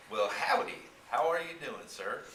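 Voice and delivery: country howdy. Acted. Clerk voiced with country greeting.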